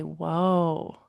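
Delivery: long, drawn-out soothing whoa with a falling end; calm slow whoa. Shown as a demonstration. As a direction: settle the upset child with a slow whoa. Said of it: "we call whoa, low, and slow".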